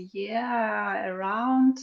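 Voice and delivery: usual speaking voice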